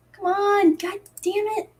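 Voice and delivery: high, exasperated come on; frustrated. Real, not acted. Vlogger fighting a glitchy YouTube upload, exasperated.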